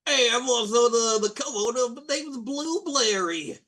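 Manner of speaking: barge in as the cooler guy who steals the scene; Blue Blairy. Acted. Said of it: "an even cooler guy ... is like".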